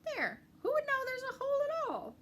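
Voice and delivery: high, loud triumphant cry; pleased exclaim. Acted. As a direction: crow over the hidden hole. Ratty hides hole, pleased.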